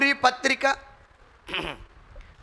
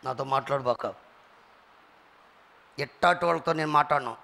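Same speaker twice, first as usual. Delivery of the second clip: mock snooty aloofness; 9 semitones lower than usual. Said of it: "కొంతమంది".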